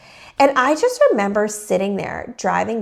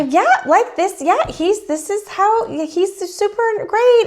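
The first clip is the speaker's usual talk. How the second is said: high, halting, flustered yeses; stumbling answer; 10 semitones higher than usual. Retold or imitated. Answering the woman on the spot, high flustered stumbling. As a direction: stumble through an unconvincing reassurance. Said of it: "I stumbled across and said something".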